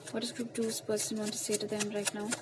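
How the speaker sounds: slow, soft, hushed asking of the cards; ritual question to spirit. Acted. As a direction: ask the spirit slowly and softly while shuffling.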